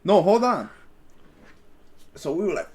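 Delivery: usual speaking voice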